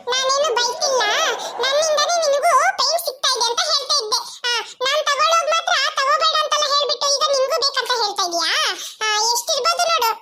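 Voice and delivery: put-on high little-girl voice; acted childish squabbling; bickering child. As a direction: argue back at a sibling, pitched high. Child argues back at older sister.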